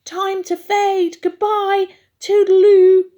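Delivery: high, loud squeaky farewell; fleeing mouse. A narrator's reading. Mice scurry away from the cat, high loud squeaky goodbye. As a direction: sing out the goodbye as you scurry off.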